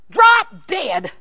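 loud, high mock outburst; comic angry shout. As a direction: yell it in exaggerated fury for a laugh. Acted outburst in prayer, for laughs.

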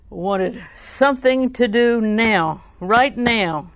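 loud, drawn-out demand. Retold or imitated. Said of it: "you raised your voice".

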